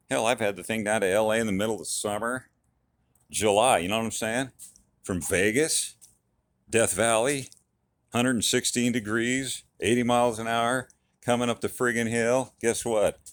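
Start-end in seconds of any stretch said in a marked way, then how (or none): none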